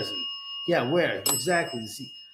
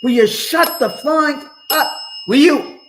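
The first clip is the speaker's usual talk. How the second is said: very high, loud exasperated yell; a real, loud exasperated outburst; furious shout; 12 semitones higher, 8 dB louder than usual. Real, not acted. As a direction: blow up at the chat. Yelling at chat to stop.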